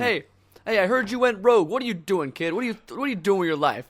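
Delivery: high, loud mock scolding; lecturing elder. Elder lecturing a kid who went rogue, high and loud. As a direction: wag a finger at a wayward kid.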